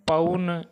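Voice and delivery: पौन with औ as a gliding 'a-u', like 'pa-un'; औ का उच्चारण. Shown as a demonstration. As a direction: glide from a to u inside पौन. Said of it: "यहाँ औ बोले". (पौन को 'पउन' जैसा बोलें)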